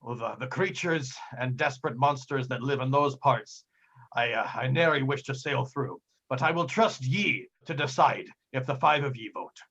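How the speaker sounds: put-on old sea captain warning his crew; measured pirate voice, lingering on the dangers; pirate captain, ominous. Captain offering the third route to a vote, ominous pirate voice.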